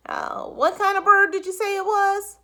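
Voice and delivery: high, loud questioning cop; voiced policeman asking about the bird. A narrator's reading.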